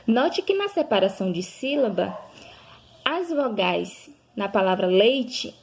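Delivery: ordinary talk, nothing marked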